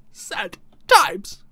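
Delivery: laughing through it. Real, not acted. Gamer laughing at not being able to rename the sign. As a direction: chuckle the words out.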